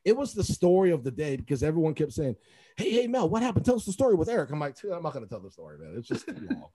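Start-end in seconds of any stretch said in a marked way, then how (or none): 2.8-4.5 higher, excited retold pleading; keen, high-pitched request; eager. Eager colleagues asking for story. 4.8-6.7 low, soft weary refusal; retold low-key brush-off; reluctant.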